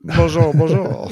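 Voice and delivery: high, singsong mock French bonjour; mock French accent. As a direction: ham up a French tourist greeting. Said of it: "perfect impression".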